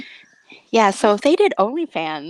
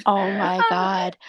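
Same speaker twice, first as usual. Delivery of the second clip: ordinary delivery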